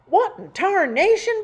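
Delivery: screamed. Retold or imitated. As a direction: shriek it out as Pa sees the fire. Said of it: "Pa screamed".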